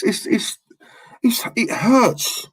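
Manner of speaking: barely getting the words out, near tears; a real catch in the throat, slow; choked up. Real, not acted. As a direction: voice cracking on "it hurts". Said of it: "That's why I get so angry".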